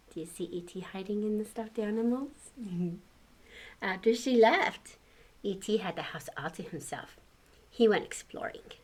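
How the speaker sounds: ordinary delivery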